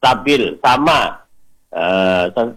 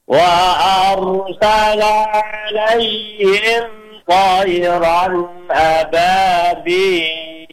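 slow melodic tartil chant; teacher modelling tajwid recitation; Quran recitation; 3 semitones higher, much slower than usual. Shown as a demonstration. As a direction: recite it measured and sung. Recites again.

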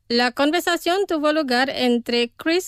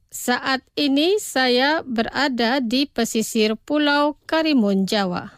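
pronunciación despacio. Shown as a demonstration. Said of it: "Los pronunciaré despacio". (pronunciado despacio, para repetir)

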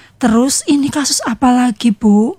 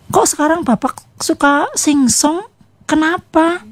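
lightly voiced wife, a bit higher; modeling wife's questions; 5 semitones higher than usual. Neighbor showing wife how to question her husband, lightly role-played. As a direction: gently ask as a wife would. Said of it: "Coba suaminya Ibu suruh berkata yang jujur".

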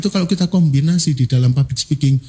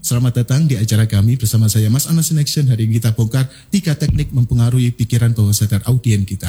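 level, even delivery lower; flat tone; 6 semitones lower than usual. Shown as a demonstration. Trainer showing the flat tone for content, level delivery. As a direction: deliver the material on one steady note. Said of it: "nada datar, menyampaikan materi".

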